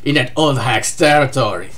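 low, lightly voiced angry warlord; a hint of a low, irritated villain; pissed-off Kaido. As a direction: grumble it low, a touch of annoyance. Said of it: "Says Kaido! Rather pissed".

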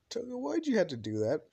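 high-pitched complaining question; whiny protest. Acted. Deku confronts Toga after the kiss, protesting. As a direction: start high and plaintive, then drop.

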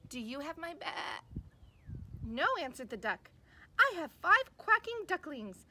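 put-on lively storybook read for little kids, big character voices; reading to children. Teacher reading a picture book to kids, animated storytelling. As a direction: read it like circle time, animated and slow.

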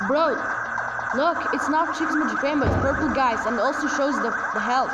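loud, fast excited shout; hyped up, grabbing viewers' attention. Real, not acted.

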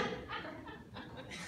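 quiet breathy chuckle; real soft laugh. Real, not acted. Preacher chuckles to the congregation at his own joke.

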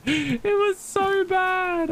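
very high, slow, squeaked-out whine; comic high-pitched wail; squeaky mock whine. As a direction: stretch it out in a tiny cartoon squeak. Exaggerated retelling of bad moment.

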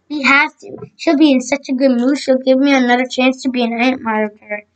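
ordinary delivery

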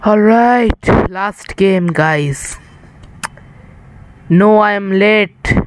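ordinary talk

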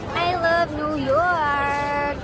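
put-on drawn-out New York accent; slow New York accent in love. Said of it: "That's my New York accent".

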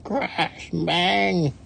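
high, drawn-out crash noises; crash and bang. A narrator's reading. Poor little driver upside down, high crash-bang. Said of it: "One poor little driver".

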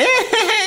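high squealed fake laugh; mock publicity laugh. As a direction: imitate his silly high-pitched cackle. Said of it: "Why does he make that face".

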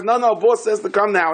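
a hint of the hired worker brushing him off; the gardener insisting, mildly imitated; gardener's reply. Gardener answers the rabbi.

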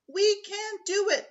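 high, loud cheer; excited cheer. Acted. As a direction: cheer it like a class. Students cheer together.

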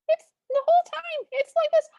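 tiny squeaky high cartoon voice; high squeaky cartoon princess; mouse voice. Shown as a demonstration. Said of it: "Cinderella has a mouse voice".

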